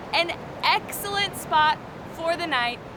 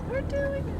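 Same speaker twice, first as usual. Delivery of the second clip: high but quieter thrill; excited, softer; 12 semitones higher, 5 dB quieter than usual. Real, not acted. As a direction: say it again under your breath. Repeats while crossing water.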